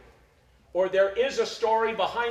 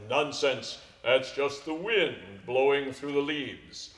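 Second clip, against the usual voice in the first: lower, slow fatherly voice; dismissive father; 6 semitones lower, 4 dB quieter, slower than usual. Acted. Father dismisses the son's fear, lower and slow. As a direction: brush it off like a steady dad.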